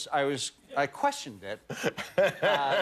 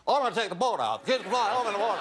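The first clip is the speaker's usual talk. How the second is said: put-on same overblown high Kennedy accent, rattled off faster; mistaught Ted Kennedy accent; 7 semitones higher, faster than usual. Actor obeying the director's note to go faster, hurried hammed-up Kennedy accent. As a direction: rush the line in a broad mock Boston accent, no softer. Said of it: "say that a little softer and, and faster".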